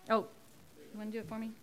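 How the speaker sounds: ordinary delivery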